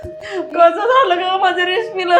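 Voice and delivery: slow, sobbing wail; crying lament. Acted. Mother-in-law lamenting over collapsed daughter-in-law, crying. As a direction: break into sobs between drawn-out words. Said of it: "रडू नका मला".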